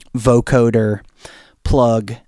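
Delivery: ordinary delivery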